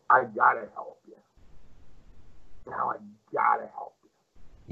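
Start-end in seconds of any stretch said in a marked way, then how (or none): 2.7-4.7 hushed whisper, a secret confided. Retold or imitated. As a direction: lean in and whisper it. Promoter's quiet final line.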